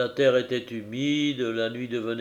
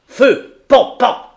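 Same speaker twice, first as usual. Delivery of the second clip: loud; 12 semitones higher, 14 dB louder, slower than usual. Acted. Firing at the lion.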